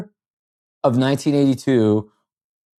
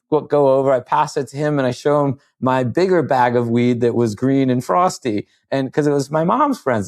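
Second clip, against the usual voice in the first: ordinary talk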